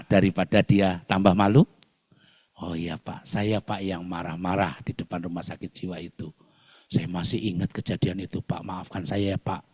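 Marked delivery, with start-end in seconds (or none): 2.6-9.7 much softer, lower humble apology; embarrassed driver apologizing softly; sheepish apology. Retold or imitated. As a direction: mumble it ashamed. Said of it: "driver apologizes, embarrassed".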